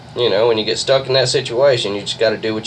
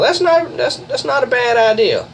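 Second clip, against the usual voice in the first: surprised; 13 semitones higher than usual. Real, not acted. Surprised reaction to teammate's plan.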